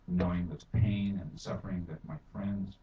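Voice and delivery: usual speaking voice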